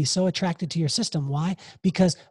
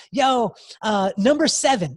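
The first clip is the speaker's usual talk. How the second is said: mock gambler's call; 5 semitones higher, 4 dB louder than usual. Acting out calling a dice number.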